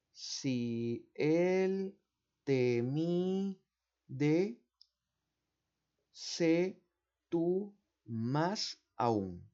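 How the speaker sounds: slow, deliberate recitation of the rhyme; reciting mnemonic. Shown as a demonstration. Teacher reciting a memory rhyme to students, slow and deliberate. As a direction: chant the memory aid word by word. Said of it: "yo me aprendí con esa mnemotecnia".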